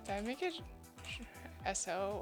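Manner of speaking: ordinary delivery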